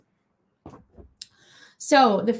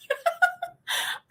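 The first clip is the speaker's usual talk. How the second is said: sudden high squealing laugh; high burst of laughter; 3 dB quieter than usual. Real, not acted. Laughing to viewers at the book title. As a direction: break into a real giggle.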